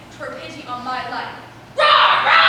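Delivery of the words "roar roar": loud roaring shouts, rising in pitch; hammed-up lion roar. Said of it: "strike as lion".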